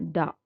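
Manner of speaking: da said light and unaccented; unstressed syllable sound. Shown as a demonstration. Teacher demonstrating an unstressed beat, light. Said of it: "For unstressed".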